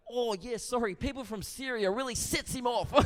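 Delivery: high-pitched apologetic Kiwi officer; New Zealand accent. Acted. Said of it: "Excuse the accent".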